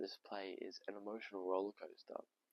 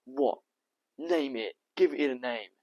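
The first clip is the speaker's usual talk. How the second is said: loud, raised, insistent challenge; confrontational demand; 5 semitones higher, 16 dB louder, slower than usual. Acted. Tom putting the doctor on the spot, loud and demanding. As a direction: hammer out each short demand.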